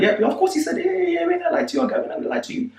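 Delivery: high mock group assurance; group insisting. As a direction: everyone backing up the story, squeaky high. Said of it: "Everyone's like".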